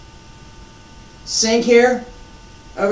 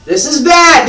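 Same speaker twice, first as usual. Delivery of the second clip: "This is bad" said louder and higher, leaning on "bad"; emphatic; 6 semitones higher, 6 dB louder than usual. Shown as a demonstration. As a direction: hammer home "bad" with a raised voice. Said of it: "Let me emphasize this again".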